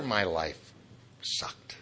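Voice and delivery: whispered "sucked"; whispered punchline. Real, not acted. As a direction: drop it to a breathy aside. Punchline dropped low.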